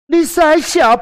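high, loud scornful jab; scornful girlfriend. Shown as a demonstration. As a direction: look down on him as you say it. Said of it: "就有点鄙视的用意".

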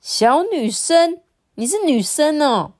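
high, drawn-out incredulity; mother's astonished query; disbelieving question. A narrator's reading. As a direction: ask as if you can't believe it, voice up. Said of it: "妈妈不敢相信的问呢".

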